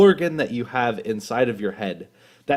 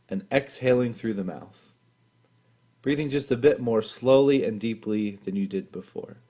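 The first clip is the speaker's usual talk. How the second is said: guided meditation; 2 semitones lower, 5 dB quieter than usual. Acted. Leader guiding listeners through a body scan, calm and soft. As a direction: ease them gently into stillness, unhurried and calm.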